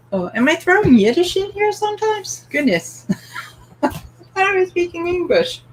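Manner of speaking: usual speaking voice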